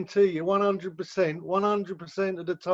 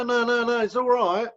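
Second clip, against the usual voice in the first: higher-pitched, placating brush-off; acted nervous people-pleasing; hurried reassurance; 6 semitones higher, 3 dB louder than usual. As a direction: wave the offer away, over-eager to please. Scared owner waving off payment.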